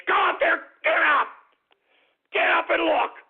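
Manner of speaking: barked, loud angry order to get up; angry shout. Acted. Radio host shouting at his listeners to get up, furious yell. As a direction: yell it at the listeners like a drill sergeant.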